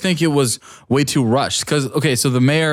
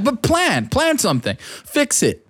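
exasperated; 9 semitones higher, slower than usual. Real, not acted. Riled up about government inaction.

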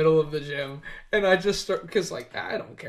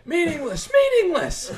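high hammed-up cry, louder on the repeat; mock screaming; 14 semitones higher, 6 dB louder than usual. Pretending to recite the Bible at a gym stranger, mock screaming. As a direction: cry it out high, building to a yell. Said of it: "I just start screaming".

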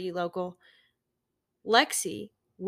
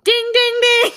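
loud high quiz bell; idea ding; 13 semitones higher, 12 dB louder than usual. Pretend. Game-show correct answer bell.

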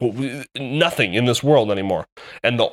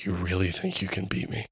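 much softer, a low menacing dare; quiet villain taunt; 4 semitones lower, 11 dB quieter than usual. Retold or imitated. The boss taunts the player before fighting, quiet menace. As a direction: murmur it like a threat in the dark. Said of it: "she's like".

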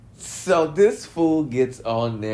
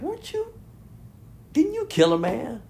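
much higher, sharp disbelieving question; incredulous. Real, not acted. To viewers, doubting the rapper's piety, with high disbelief. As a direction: shoot up in pitch in disbelief.

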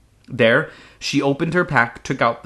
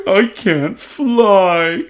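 slow, higher, louder tearful wail; sobbing; 8 semitones higher, 7 dB louder, much slower than usual. Acted. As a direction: drag it out through a sob. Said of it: "he sobbed".

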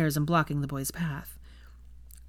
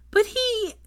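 put-on high-pitched little boy objecting; protesting child. A narrator's reading. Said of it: "started to protest again".

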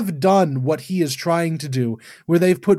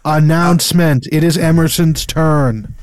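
loud announcer-style proclamation; mock announcement; 5 dB louder than usual. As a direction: proclaim it loudly to the room. Mock announcement introducing the next task.

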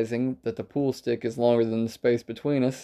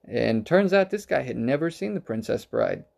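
ordinary talk

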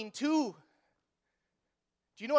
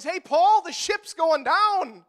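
mock shout to Paul; 6 semitones higher, 8 dB louder, much slower than usual. Pastor joking to Paul on ship.

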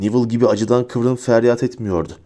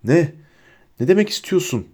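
raised pitch, a touch of alarm; fearful shout; 6 semitones higher than usual. A narrator's reading. Bellatrix learns something is wrong, mildly fearful shout. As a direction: demand an answer, mildly rattled. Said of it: "diye bağırdı Bellatrix".

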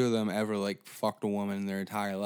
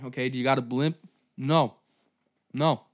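usual speaking voice

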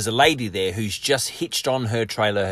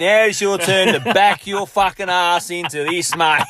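slower, higher, lightly put-on trucker dare; mock trucker taunt; 6 semitones higher, 4 dB louder, slower than usual. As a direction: goad him, slowly and lightly. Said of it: "and said".

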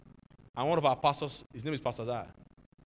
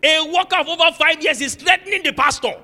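loud, high, outraged preaching; furious retold rant; 6 semitones higher, 10 dB louder than usual. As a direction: relive the rage at the workers' meeting. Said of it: "I was most furious".

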